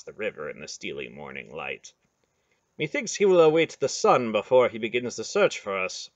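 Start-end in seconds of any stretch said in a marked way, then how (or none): 2.8-6.1 archaic knight. A narrator's reading. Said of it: "Mandorlan observed".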